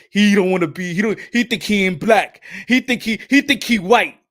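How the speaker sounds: stammering, scandalized imitation; mock indignation. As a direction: mimic an affronted onlooker, pitch climbing. Mimics offended reaction to Dominicans.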